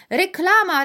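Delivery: very high, loud excited shout. A narrator's reading. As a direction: call it out to the whole store. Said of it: "wołali kupujący".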